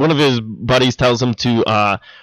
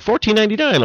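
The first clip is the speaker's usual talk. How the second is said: high mock voice; 10 semitones higher than usual. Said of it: "it's all like".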